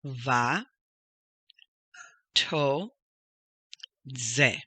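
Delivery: VA, TO, ZE as three separate syllables; teacher breaking up va-to-ze piece by piece. Shown as a demonstration. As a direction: sound out va, to, ze with long gaps. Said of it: "So we have".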